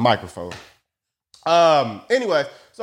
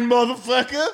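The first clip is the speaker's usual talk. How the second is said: ordinary talk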